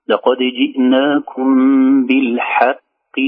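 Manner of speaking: لقد جئناكم بالحق slow, حاء of بالحق kept light; slow careful Quran reading; correct recitation. Shown as a demonstration. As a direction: recite slowly, qaf of بالحق not heavy. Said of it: "نقول".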